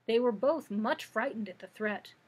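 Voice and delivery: usual speaking voice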